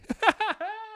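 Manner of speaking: high giggling chuckle; real amused laugh at own joke. Real, not acted.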